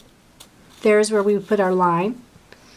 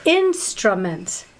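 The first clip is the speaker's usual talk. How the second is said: slow demo word; much slower than usual. Shown as a demonstration. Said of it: "the next word is".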